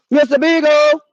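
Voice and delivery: high call with a held last syllable. Pretend. Owner searching for missing dog, high holler. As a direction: holler for a lost pet.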